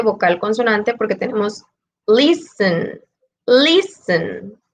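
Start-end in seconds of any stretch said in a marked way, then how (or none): 2.1-4.6 "listen" drawn out as LIS-ten, each time set apart; stress on first syllable. Shown as a demonstration. Teacher showing students the tonic syllable, "listen" stressed on LIS. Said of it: "La palabra lis-, en esa se encuentra la, la sílaba tónica".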